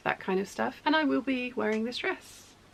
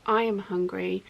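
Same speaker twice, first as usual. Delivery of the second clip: ordinary delivery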